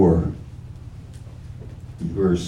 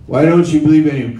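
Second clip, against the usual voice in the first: questioner's voice; 5 semitones higher, 5 dB louder, much faster than usual. Acted. Voicing the questioner.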